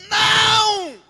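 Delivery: high, very loud, drawn-out yell; shouted refusal. Acted. Eve should have answered the serpent, shouting in refusal. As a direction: scream one long indignant word. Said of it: "Devia gritar ali. Ela devia falar assim".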